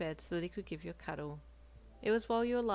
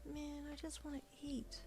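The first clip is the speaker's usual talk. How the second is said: much softer, slow grumble; hungry wife mumbling to herself; 10 semitones higher, 10 dB quieter than usual. Acted. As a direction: grumble it under your breath. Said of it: "mumbling to yourself".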